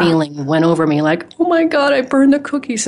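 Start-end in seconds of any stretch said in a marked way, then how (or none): 1.4-2.9 high, loud panicked gasp of realization; the sudden horror of burnt cookies; panicked child. Retold or imitated. Child in the kitchen realizing she burned the cookies, high panicked cry.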